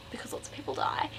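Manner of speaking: hushed aside whisper. Real, not acted. Book vlogger whispering a dark plot detail. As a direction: drop to a whisper for the grim bit.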